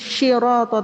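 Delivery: "Shiroto" begun with a hushing sh; sod as shin. Shown as a demonstration. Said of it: "Merubah sod menjadi shin". ("Shiroto" dengan sod jadi syin)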